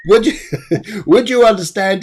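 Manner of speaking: real laughter breaking into the question; giggling while asking if she understands; laughing. Real, not acted. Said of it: "but I've got a laugh".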